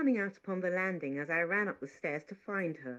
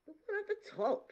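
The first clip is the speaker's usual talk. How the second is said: higher, impatient maid. Acted. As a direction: snap it out impatiently. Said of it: "she said impatiently".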